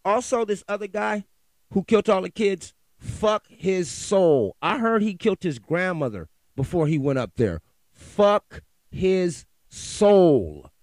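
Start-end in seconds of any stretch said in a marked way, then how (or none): 3.0-4.6 slow, drawn-out, louder angry curse. Real, not acted. Ranting podcaster curses a killer, slow and loud. 7.9-10.7 very slow, loud, stretched-out curse; bellowed curse. Real, not acted. Podcaster repeats his curse at the killer, bellowing slowly. Angry rant about a killer.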